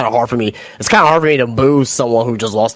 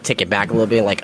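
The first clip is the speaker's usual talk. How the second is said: ordinary talk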